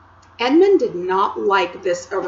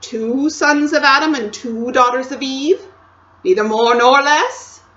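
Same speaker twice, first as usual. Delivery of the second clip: louder, higher insistent queen; 6 semitones higher, 9 dB louder than usual. A narrator's reading. Queen questioning Edmund closely, loud and insistent. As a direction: press hard, almost a demand.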